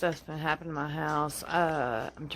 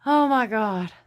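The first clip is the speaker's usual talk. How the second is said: louder, higher shocked gasp of words; real shock after the slam; shaken; 5 semitones higher, 6 dB louder than usual. Real, not acted. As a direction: blurt it out in fright.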